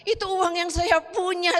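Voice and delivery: high, loud retold protest; acting out an upset objection; dismayed protest. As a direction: protest in dismay, pitched well up. Retelling her dismayed protest to God.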